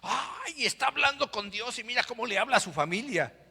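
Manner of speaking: high, teasing voice; mock the pastor-dad sarcastically; mocking son. Son mocks his father for yelling, high and snide.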